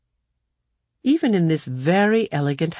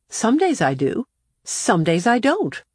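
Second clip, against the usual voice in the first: put-on higher, cool young girl; 7 semitones higher than usual. A narrator's reading. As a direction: voice a self-assured girl. Claudia answering coolly.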